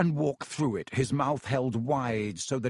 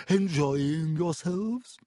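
gracious ghost host; 4 semitones higher, much slower than usual. Acted. Ghost host greeting guests.

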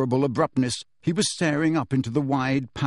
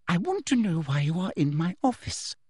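Umbridge menace; 4 semitones higher than usual. Acted. Umbridge interrogating Harry, sugary menace. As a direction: press him sweetly.

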